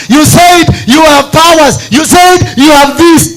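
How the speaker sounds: loud, high shouted sermon; full-force preaching. Acted. Preacher challenging God with the people's problem, shouted fervently. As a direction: thunder it out high, driving hard at God.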